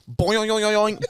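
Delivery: playful cartoon boing; bouncy ball noise. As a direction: bounce the word like a rubber ball. Ball bouncing off his foot.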